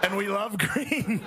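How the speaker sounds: high, slow presidential impression; Donald Trump. Comic imitates president admiring a map, drawn-out Trump voice. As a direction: draw it out like a delighted mock politician. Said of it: "Donald Trump was staring at a map going".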